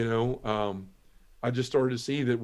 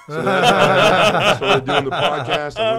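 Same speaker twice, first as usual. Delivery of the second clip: laughs the words out, louder and slower; a big laugh while speaking; 6 semitones higher, 11 dB louder, slower than usual. Real, not acted. Guest laughing through his podcast origin story.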